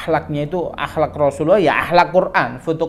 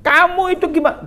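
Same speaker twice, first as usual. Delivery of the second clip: angry Umar; 4 dB louder than usual. Retold or imitated. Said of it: "Sayyidina Umar marah".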